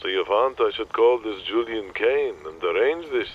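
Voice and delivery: put-on old agent with a Yiddish accent; dry Yiddish accent, talking sense. Morty the agent.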